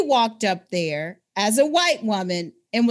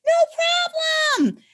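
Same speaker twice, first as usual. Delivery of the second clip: much higher, loud, sing-song eager falsetto; overly eager helper; 5 dB louder, much slower than usual. Retold or imitated. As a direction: gush a cheery yes like a too-eager race volunteer. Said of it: "They were like".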